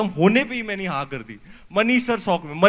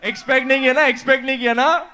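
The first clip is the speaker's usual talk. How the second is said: higher, lively and eager; bubbling over after the trailer; excited; 5 semitones higher, 4 dB louder, faster than usual. Real, not acted. Actor reacting to the trailer, excited.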